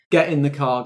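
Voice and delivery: ordinary talk, nothing marked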